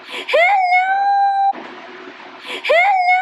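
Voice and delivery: very high, drawn-out squeal. Real, not acted. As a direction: coo excitedly in a high, sing-song voice. Greeting her little guy on getting home.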